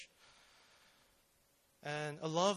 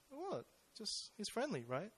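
high, startled what, then playing it down; surprised denial; 6 semitones higher, 4 dB quieter than usual. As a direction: act innocent, caught off guard. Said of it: "And she was like".

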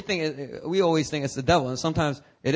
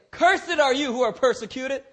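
mock wrong quote; 10 semitones higher than usual. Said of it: "He didn't say".

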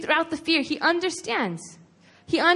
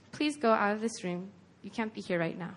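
low, soft request to leave; quietly acted, calm instruction; quiet dismissal; 4 semitones lower, 8 dB quieter than usual. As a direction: gently send them out, keeping it hushed. Jesus gently setting mourners aside.

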